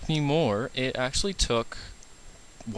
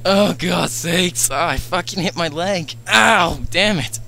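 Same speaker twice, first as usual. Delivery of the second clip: pained outburst; 5 semitones higher, 7 dB louder, slower than usual. Real, not acted. Hit his leg after the scare.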